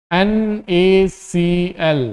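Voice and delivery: each letter of N-A-C-L slowly apart. Shown as a demonstration. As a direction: spell the formula out very slowly. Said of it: "spells out formula". (धीरे-धीरे अक्षर बोलना)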